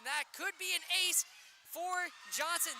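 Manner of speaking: loud, high, slowed shout; excited shout. Real, not acted. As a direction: yell the ace call. Possible ace, live call.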